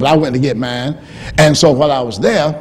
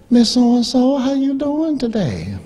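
higher, gentle greeting; a hint of a sweet nurse; 10 semitones higher, slower than usual. Nurse at the booster station greeting an old lady, lightly voiced.

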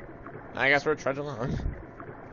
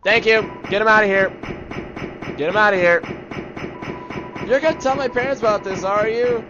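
high, loud, urgent yelling; agitated shouting; 8 semitones higher, 8 dB louder than usual. Acted. Game kid worried about parents, agitated shouting.

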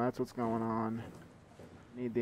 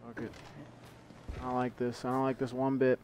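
ordinary talk

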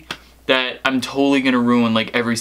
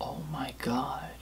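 soft breathy whisper under the hold message; whispered aside; 16 dB quieter than usual. Real, not acted. Prankster whispers in disbelief while on hold. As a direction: whisper so the line can't hear.

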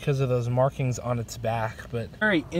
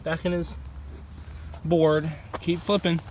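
normal talking voice

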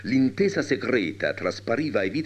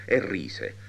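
ordinary delivery, nothing marked

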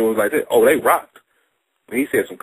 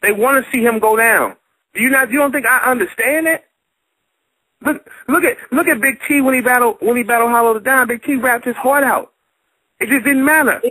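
high, heated insistence; high, animated, insistent arguing; heated argument; 10 semitones higher than usual. Real, not acted. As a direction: hammer the point home. Heated argument about booing.

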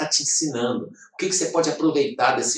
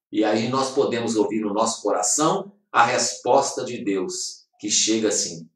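normal talking voice

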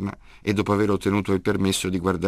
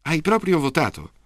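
put-on much higher, eager child asking; excited question; 9 semitones higher than usual. A narrator's reading. Girl pressing grandpa, excited and higher. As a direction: press him, amazed.

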